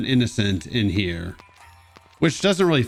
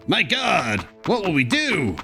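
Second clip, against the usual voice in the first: sarcastic fake panic, higher voice; mock alarm; 6 semitones higher than usual. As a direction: gasp it out like a melodramatic damsel. Sarcastic mock panic over kidnapping.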